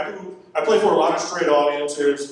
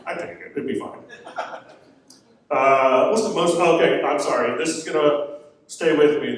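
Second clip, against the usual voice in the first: ordinary delivery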